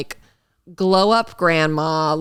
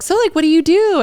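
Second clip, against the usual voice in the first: high and louder flirty cheerleader; mock flirty question; 11 semitones higher, 5 dB louder, faster than usual. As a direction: pitch way up and coo the question. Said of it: "she was like".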